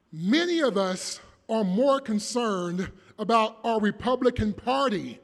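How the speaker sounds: usual speaking voice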